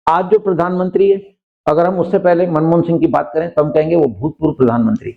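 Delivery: ordinary talk, nothing marked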